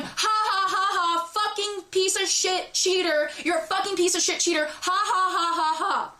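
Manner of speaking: high mock scream with jeering ha-has; retold taunting scream. Pointing at the cheater in the street, high mock-screamed jeering. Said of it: "I am screaming at the top of my fucking lungs".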